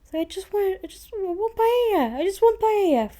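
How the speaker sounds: put-on high, whining little-kid voice; whiny child. Told off like a naughty child, she whines for paella. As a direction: whine it like a child denied a treat.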